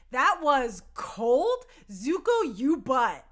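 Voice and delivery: slow, drawn-out and loud; stunned verdict on a betrayal; slow disbelief. Real, not acted. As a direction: let the words hang in outrage. Outraged at Zuko's betrayal.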